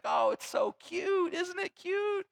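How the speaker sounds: put-on sappy baby-talk gush; mock doting parent. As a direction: coo it like a besotted parent. Parent gushing mockingly over crash.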